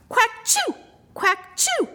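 very high quacky duck sneeze; sneezing duck. A narrator's reading. Narrator as a duck, sneezing high. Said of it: "went duck".

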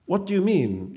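high, loud demand. A narrator's reading. Boy confronts the phantom for answers, demanding. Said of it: "Luca demanded".

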